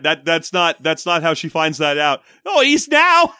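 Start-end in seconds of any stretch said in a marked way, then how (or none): 2.4-3.3 high-pitched British maid, hammed-up; fake British accent. Maid finally telling on the killer, fake British accent. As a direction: play a squawking British maid in a bad accent. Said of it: "She's doing a fake British accent".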